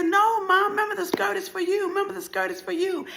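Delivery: put-on very high, urgent child's plea; high-pitched acted pleading; desperate pleading. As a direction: beg her to remember. Child begs mother to stop.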